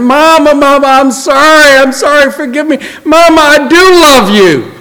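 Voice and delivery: imitated daughter crying out, very high; urgent, high-pitched plea for forgiveness; desperate apology. Janet running into the house to her mama, desperately apologizing. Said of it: "I could hear her saying".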